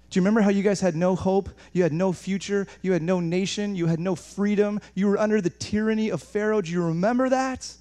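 impassioned reminding, pitch climbing to the last question; building insistence. Acted. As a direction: press each point harder, building to a peak. Voices God pressing Israel to remember.

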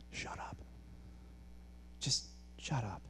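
very soft, near-whispered put-down; hushed, deliberate shutting-down; hushed rebuke. Acted. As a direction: drop right down and quietly dismiss him. Blunt rebuke to Satan, said hushed.

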